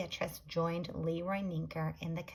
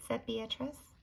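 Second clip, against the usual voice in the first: usual speaking voice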